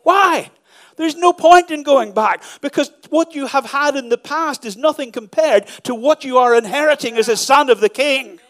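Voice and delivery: full-force preaching. Acted. Pastor urging the congregation, rising preaching. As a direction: lift the room with every line. Preaching climax, loud and high.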